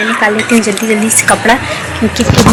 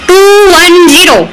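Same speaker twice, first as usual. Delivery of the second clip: cheerful countdown in the lift; playful countdown; 8 semitones higher, 4 dB louder, slower than usual. Real, not acted.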